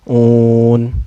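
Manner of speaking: "Un" held long before the N; elongated vowel. Shown as a demonstration. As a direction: sustain the U of "Un" on one note. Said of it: "dipanjangkan boleh dua harakat". ("Un" dibaca panjang)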